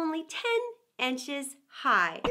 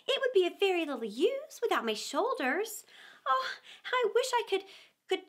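put-on higher, crying little-girl voice; tearful Alice; 6 semitones higher than usual. A narrator's reading. Alice wishing she could shrink, tearfully pleading. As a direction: let her voice break with longing. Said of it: "thought poor Alice".

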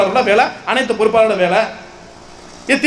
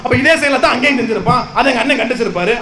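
normal talking voice

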